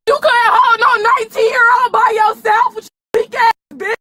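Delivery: crying while shouting at the officers in real rage; sobbing fury, words spat out; tearful angry yelling. Real, not acted. Arrested woman screaming at the police, crying and furious.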